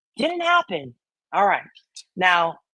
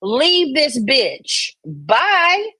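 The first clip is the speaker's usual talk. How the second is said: higher, mocking sass with a slow kiss-off; sassy dismissal; 7 semitones higher, slower than usual. Tiffany kicking Muscles out of her Discord, high and mocking. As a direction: brush her off, drawn-out and sassy. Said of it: "And Tiffany is like".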